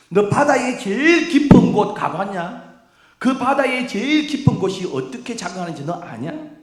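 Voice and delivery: loud, high, challenging interrogation; God's barrage of questions. Acted. God firing questions back at Job, loud high challenge. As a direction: hurl each question like gunfire. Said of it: "따발총처럼 막 질문을 던지기 시작합니다".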